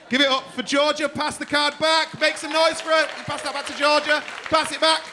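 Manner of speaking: loud, high, pumping the crowd up; loud crowd call. Real, not acted. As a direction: shout it to the back of the room to get applause. Comedian rallying crowd applause.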